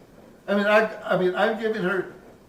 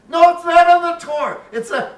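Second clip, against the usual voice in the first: loud, high exasperated no; exasperated correction; 11 semitones higher, 11 dB louder than usual. Retold or imitated. Nun correcting the student, loud high exasperation. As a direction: cut him off, exasperated.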